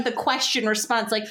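usual speaking voice

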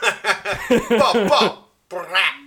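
hammed-up dancehall gun sounds; mock gunshot ad-lib. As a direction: spit out vocal gunshots, playfully. Guest imitating [name] gunshot ad-lib.

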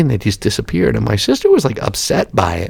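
usual speaking voice

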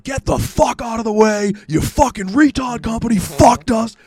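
slow, high, loud retold shouting; re-enacted full-volume fury; losing temper. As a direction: scream them out of the way. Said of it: "I have a temper if I'm annoyed".

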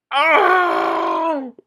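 high, loud shocked outburst; shocked shout. Real, not acted. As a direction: yelp of disbelief. Shocked reaction to movie.

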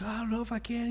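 high, small, timid voice; a nervous kid squeaking out his doubt; scared recruit. Retold or imitated. Recruit refusing the sergeant at the plane door, timid squeak. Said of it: "And he's like".

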